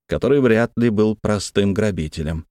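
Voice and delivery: plain reading voice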